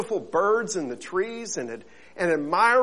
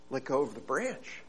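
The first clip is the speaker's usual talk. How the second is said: soft, lower calm instruction; calm command; 4 semitones lower, 11 dB quieter than usual. Acted. God calmly telling the man to let go. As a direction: tell him plainly and gently. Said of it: "I said".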